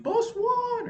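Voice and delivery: high, strained mock shout; mock yell. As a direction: imitate a yell in a very high voice, no real volume. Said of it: "'cause I was yelling".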